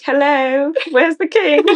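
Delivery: high, hammed-up British accent; a jokey pretend British accent; mock British accent. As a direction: play a silly tourist asking for the king in a British accent. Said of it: "It's the same accent".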